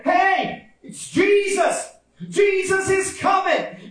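high, drawn-out calling; excited announcing. Acted. Telling the other blind man Jesus comes, high excited call. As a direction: call out the great news.

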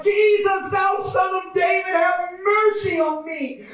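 high, impassioned pleading shout; crying out. Acted. Blind men begging Jesus for mercy, high fervent cry. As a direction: cry out to be heard, drawn-out. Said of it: "And they cried out".

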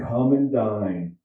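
Jesus' gentle call. Acted. Jesus voiced in scripture reading.

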